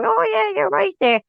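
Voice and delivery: high, eager mock yes-man; imitating George eagerly agreeing; mock eager agreement. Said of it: "I think Stuart was expecting George to go".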